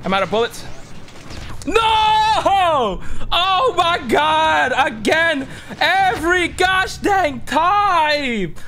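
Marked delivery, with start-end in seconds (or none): 1.7-8.5 high, drawn-out wail of frustration; anguished shouting. Real, not acted. Gamer who keeps dying every time, anguished shouting. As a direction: shout it out in agonized disbelief. Player dies in game yet again.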